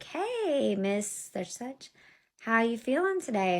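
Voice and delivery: high, drawn-out cheery greeting; singsong phone greeting, imitated; mock-friendly caseworker. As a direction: imitate an official's overly sweet hello. Imitating social worker's greeting on call.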